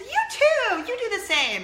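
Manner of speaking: squealing eager reply; overexcited reply. Retold or imitated. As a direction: blurt it back too eagerly. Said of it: "And I said".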